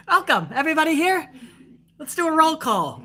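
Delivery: high, limp mock commentator; sing-song mockery of a bland play-by-play voice. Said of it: "He's just like".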